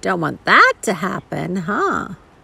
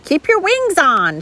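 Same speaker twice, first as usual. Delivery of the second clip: raised, higher-pitched call; mild shout; 9 semitones higher, 4 dB louder than usual. A narrator's reading. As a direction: call it out without a full yell. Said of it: "shouted Mister Little".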